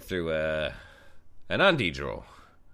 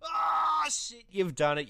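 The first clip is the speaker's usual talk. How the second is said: shout. Real, not acted. Podcast outro kicks in.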